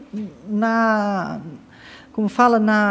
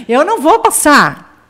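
slow, higher, a touch louder; mock defiant vow; 6 semitones higher, 4 dB louder than usual. Sister vowing to fail the test, slow and mock defiant. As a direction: declare it slowly like a stubborn girl. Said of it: "eu vou fazer de conta".

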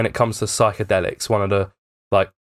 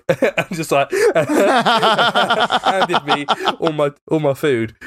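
breaking into real laughter mid-story; giggling while telling a story, can hardly get it out; laughing; 7 semitones higher, slower than usual. Real, not acted. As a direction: laugh through the words. Laughing while retelling dazed moment.

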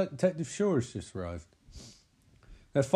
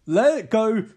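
mock shout; 6 semitones higher, 8 dB louder than usual. Boss orders detective off the case.

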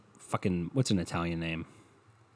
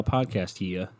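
normal talking voice

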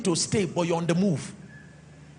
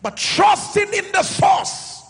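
raised, slow, hammered list; emphatic testimony preaching; 8 semitones higher, 4 dB louder, slower than usual. Real, not acted. Pastor testifying he had no sponsor or parents, preaching emphatically. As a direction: punch out each phrase as proud testimony.

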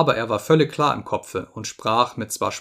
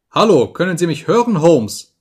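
loud, raised, insistent; sharp wake-up call; 6 semitones higher, 8 dB louder than usual. A narrator's reading. Visitor waking the sick man, sharp loud call. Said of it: "in dem scharfen Tone jemandes".

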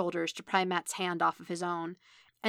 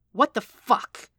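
loud, slow, incredulous outburst; furious, drawn-out exclamation; spat angrily; 5 semitones higher, 8 dB louder, slower than usual. A narrator's reading. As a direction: spit it out in disbelief. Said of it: "Stick spat".

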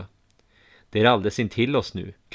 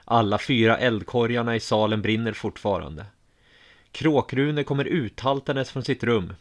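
usual speaking voice

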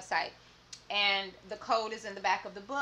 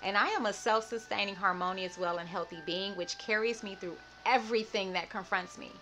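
self-love affirmation; 2 semitones lower than usual. Acted. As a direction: declare every line like a vow. Go ahead and repeat after me.

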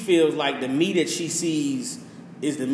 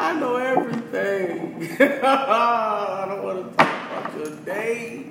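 1 laughing; 4 semitones higher, slower than usual. Real, not acted. Laughing at his own joke.

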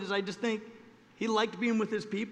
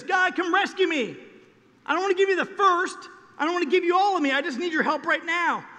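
higher, louder needy plea to God; pleading; 8 semitones higher, 6 dB louder than usual. Retold or imitated. Churchgoer begging God for rescue only, pleading. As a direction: beg for help but hold back.

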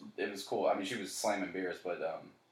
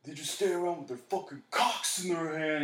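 mock drunk rant, pitched much higher; imitating a belligerent drunk yelling at the bar; retold drunk yelling; 8 semitones higher than usual. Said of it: "He just started yelling cocks and shit".

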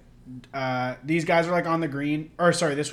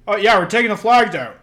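louder, higher call from across the green; mock call from the green; 4 semitones higher, 9 dB louder than usual. Golfer on the green calling back, raised voice.

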